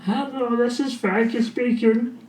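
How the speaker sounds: lightly faked other personality answering; mocking a patient switching to an alter. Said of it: "They go".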